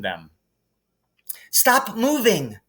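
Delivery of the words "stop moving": slow, higher firm command; a stern girl's command; ordering. A narrator's reading. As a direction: order them to freeze. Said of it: "Hermione ordered them".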